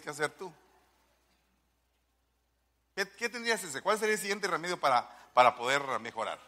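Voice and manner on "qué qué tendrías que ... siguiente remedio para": ordinary talk, nothing marked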